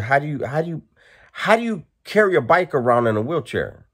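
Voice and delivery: incredulous. Retold or imitated. Incredulous question to the man.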